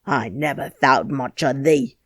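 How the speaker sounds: angry harangue. Acted. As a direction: rant at her, building scorn. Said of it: "he harangued".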